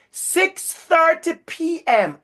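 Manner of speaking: "Six thirty P M" drawn out high and slow, each word hit; emphatic, slow. Real, not acted. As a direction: lean hard on "Six thirty P M", slowly. Stressing how late it is.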